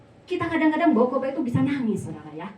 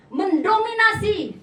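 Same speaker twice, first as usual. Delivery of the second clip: high, slow, loud proclamation; forceful emphasis; 9 semitones higher, 5 dB louder, slower than usual. Real, not acted. Preacher describes the lion as royal emblem, forceful. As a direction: stretch it out with commanding force. Preacher stresses lion's dominance.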